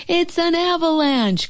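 high, alarmed shout; alarmed cry. Acted. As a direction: sound the alarm. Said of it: "cried Toad".

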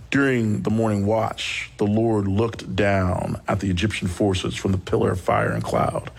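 low, measured reading of the passage; low solemn Bible reading; scripture reading. Acted. Reads scripture passage aloud.

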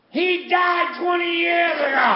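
high, loud comic outburst as dad; imitated dad shouting, pitch way up; dad's indignant yell. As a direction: yell it back as an outraged father. Dad dismisses his doctor's advice.

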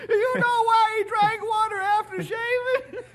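high, squealing laughter through the punchline; helpless laughing. Acted. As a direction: crack up at your own joke while telling it. Laughs through his own punchline.

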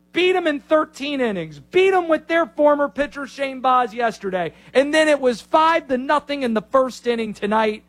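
high, emphatic mock-rant voice; punchy impression, pitched high with pauses between bursts; Linnell. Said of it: "I'm gonna do my best Linnell impression".

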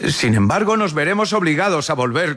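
high-pitched voice of the twins; twins' reply. A narrator's reading. Twins answer the shopkeeper together. (réplica de los gemelos en voz muy aguda)

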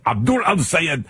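mock Arabic accent on the name; showy Arabic-accented name; exaggerated Arabic pronunciation. Shown as a demonstration. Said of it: "let me just get this right".

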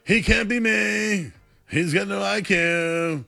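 high, slow, drawn-out bad impersonation; Donald Trump. Host mocking the president's put-down of a pundit, horrible impersonation. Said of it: "that was a horrible Trump impersonation".

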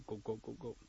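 soft repeated glug noises; quiet vocal gulps; imitated gulping. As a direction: mimic swallowing a potion. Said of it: "the mole drinks up".

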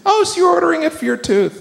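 high, fast, sarcastic voice; mock the excuse up high and fast; mock sarcasm.

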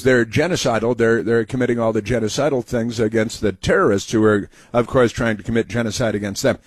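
ordinary delivery

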